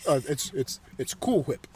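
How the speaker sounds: low, soft correcting reply; Brian. Acted. Brian correcting Stewie, low soft voice. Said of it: "What's the matter, Brian?".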